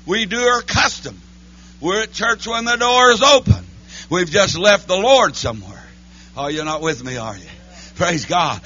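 ordinary talk, nothing marked

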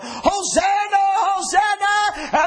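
high, loud, drawn-out Hosanna shout; exultant full-voiced preacher's shout; shouted praise. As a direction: cry out like jubilant children worshiping. Acts children shouting praise to God.

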